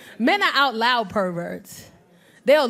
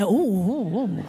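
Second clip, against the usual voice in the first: leering ooh; 6 semitones lower, much slower than usual. Retold or imitated. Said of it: "we be looking like".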